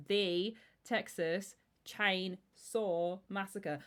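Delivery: "the Texas Chainsaw Massacre" slowly, each word set apart; spaced-out title. Shown as a demonstration. Spacing out the original film title.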